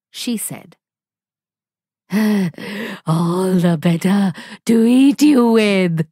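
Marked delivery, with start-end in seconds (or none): 2.1-3.0 short, wicked wolf chuckle; a hungry wolf's gleeful laugh. Acted. Wolf before eating her. 3.0-6.1 loud, menacing, grinning wolf. Acted. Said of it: "grinned the wolf".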